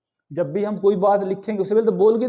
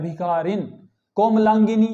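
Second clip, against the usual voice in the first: ordinary delivery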